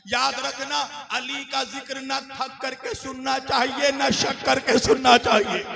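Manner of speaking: loud admonishing sermon voice, building in force; emphatic preaching. Acted. Preacher admonishing tired listeners, emphatic preaching.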